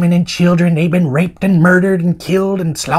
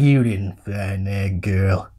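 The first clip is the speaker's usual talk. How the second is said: low, very slow, grave drawl; John Wayne; 8 semitones lower, slower than usual. Acted. Uncle correcting the searcher about the girl, slow low drawl. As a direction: drag it out heavily, deep and haunted. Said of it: "doing an impression of John Wayne through my stupid cowboy".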